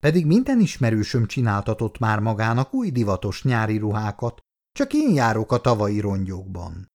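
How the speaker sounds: usual speaking voice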